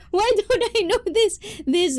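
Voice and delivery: high-pitched, laughing bewilderment; laughing disbelief. Real, not acted. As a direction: ask it helplessly through a laugh. Laughing at own ignorance.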